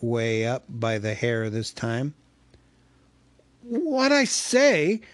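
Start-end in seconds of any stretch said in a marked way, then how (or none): 3.6-5.0 high, slow, breathless reply; gasping hobbit. Acted. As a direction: gasp it out while held up by the hair.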